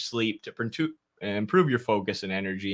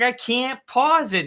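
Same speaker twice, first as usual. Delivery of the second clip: high-pitched, louder mock outburst; retold angry protest; 13 semitones higher, 5 dB louder, slower than usual. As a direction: play an exasperated kid arguing back. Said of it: "I was getting angry".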